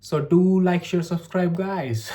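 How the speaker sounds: very slow, stretched-out outro call; a slow, stretched YouTuber plea to share and subscribe; drawn-out outro. Shown as a demonstration.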